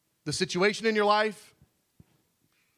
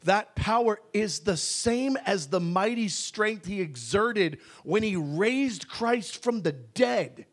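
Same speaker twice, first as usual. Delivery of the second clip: normal talking voice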